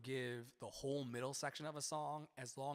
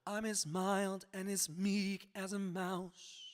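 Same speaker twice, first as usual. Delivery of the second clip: sung slowly, leaning on "mild"; copying Tara's emphasis; 3 semitones higher, slower than usual. Shown as a demonstration. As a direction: echo her stress on "mild". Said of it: "her emphasis words on that phrase is mild".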